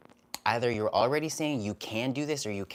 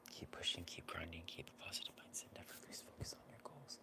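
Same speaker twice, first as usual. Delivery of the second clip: breathy whisper, quick; hushed whisper of encouragement; softly whispered chat message; whispered read; 3 semitones lower, 18 dB quieter, faster than usual. Acted. Reading a supportive chat message.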